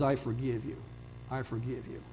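softly, fading to a hush; a father forgiving his son, quietly; tender forgiveness. Retold or imitated. Father to runaway son, tender and hushed.

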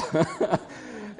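a short airy laugh at his own joke; light breathy laugh. Real, not acted. Laugh after tall joke.

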